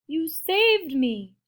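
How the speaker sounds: high, drawn-out grateful cry. A narrator's reading. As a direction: marvel at the rescue, slowly. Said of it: "Big Bark cried".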